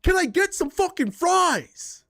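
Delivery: high, slow snarl for fries; mock rude demand. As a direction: mimic a rude customer, drawn out and high. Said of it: "imagine going to a worker and being like".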